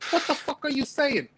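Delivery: high, strained mock yell; imitating a baffled, furious man; incredulous movie quote. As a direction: half-shout it in disbelief. Quoting the movie character.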